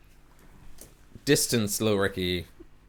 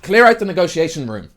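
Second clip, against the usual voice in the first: higher, louder orc order; shout; 7 semitones higher, 9 dB louder than usual. Acted. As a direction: bark it across the deck. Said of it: "And just shouts".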